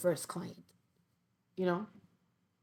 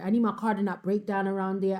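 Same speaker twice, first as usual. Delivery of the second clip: usual speaking voice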